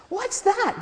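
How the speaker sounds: high, sharp yelled question; mock yell. A narrator's reading. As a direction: call it out like a rowdy kid. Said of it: "they would yell".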